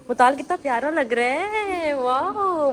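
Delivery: high-pitched cooing praise; cooing admiration. Real, not acted. Aunt admiring shy little boy, cooing.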